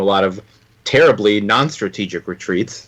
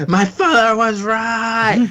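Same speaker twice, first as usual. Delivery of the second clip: mock scream; 11 semitones higher, slower than usual. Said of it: "he screams".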